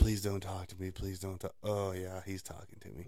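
hushed, sinking mock plea; dreading inner voice. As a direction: think it quietly, hoping to go unnoticed. Retold inner dread of being approached.

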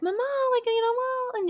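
put-on high-pitched little kid voice; imitated child calling. Simone at her feet calling his mother, imitated child voice.

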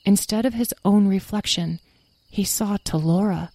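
ordinary delivery, nothing marked

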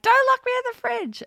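mock panic, high and loud; panicked squeal. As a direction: squeal it like she's trapped. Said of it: "But she was like".

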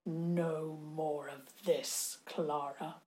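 very slow, low, soft growl; quiet menace. A narrator's reading. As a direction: drop it down and draw it out as a warning. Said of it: "then spoke so quietly".

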